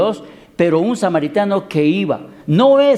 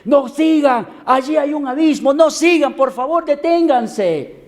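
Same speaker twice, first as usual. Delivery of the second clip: urgent warning; 9 semitones higher than usual. Acted. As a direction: shout a desperate warning to the crowd. Young man warns crowd at abyss. (grito de advertencia desesperado, agudo y fuerte)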